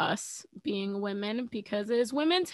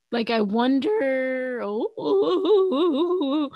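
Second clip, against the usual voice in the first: voice cutting out; 4 semitones higher, 5 dB louder, much slower than usual. Real, not acted. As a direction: voice breaking up mid-word. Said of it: "my voice was cutting out".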